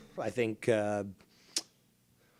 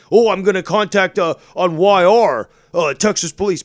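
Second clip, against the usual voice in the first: mocking imitation; 5 semitones higher, 9 dB louder than usual. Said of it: "Which one are you referring to?".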